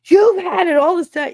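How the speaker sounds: high, loud disbelieving question; astonished. Retold or imitated. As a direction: blurt it out in shock. Retelling his boss's astonished question.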